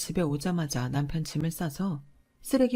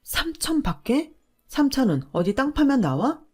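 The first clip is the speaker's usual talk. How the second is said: incredulous anger; 2 semitones higher, 5 dB louder than usual. Acted. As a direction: throw his words back at him. Wife incredulous at husband's words. (남편 말을 되받아치는 아내, 어이없어 화난 목소리)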